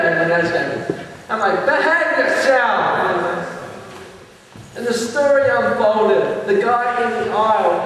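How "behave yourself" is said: long drawn-out reprimand; retold scolding. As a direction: stretch it slowly like a mock telling-off. Comedian scolds a fighting audience.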